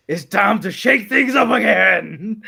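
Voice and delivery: slow, loud, laughing boss announcement; Vince McMahon impression. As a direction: bellow it like a wrestling boss. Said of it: "Vince saying".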